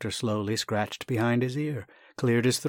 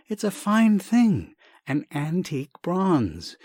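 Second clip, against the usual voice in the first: slow, much higher, louder eager praise; 8 semitones higher, 4 dB louder, slower than usual. Acted. Young man pressing a gift on the doctor, gushing eagerly. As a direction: sell it to him with gushing delight.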